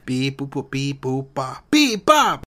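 toy robot voice. Acted. Robot noises.

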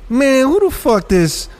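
a hint of a scornful street player; mock trash talk.

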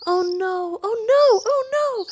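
very high, fretful bird cries; nervous chattering swifts. A narrator's reading. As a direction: twitter it in comic dread. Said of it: "chattered the nearby swifts".

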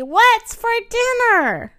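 high, slow, drawn-out question; eager. A narrator's reading. Fluffy thinking of dinner instead of stars, eager.

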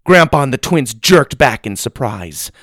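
ordinary delivery

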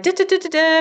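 high, bouncy little trotting syllables; carefree sing-song. Retold or imitated. Telling of setting off down a Sedona trail, carefree high sing-song. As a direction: chirp it like a happy skip along.

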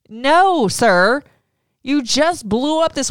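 no way, sir, outraged; indignant. Real, not acted. Viewer rebuking Tom for his insult, indignant.

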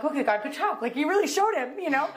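mock-congratulating God with a grin; playful praise. Teasing God for proving her husband wrong, cheeky and high.

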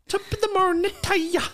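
put-on very high mangled Irish accent; mock Israeli-Irish. Co-host mocks her unplaceable accent, high muddled Irish. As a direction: squeal a muddled Irish greeting. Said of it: "It could have been Israeli".

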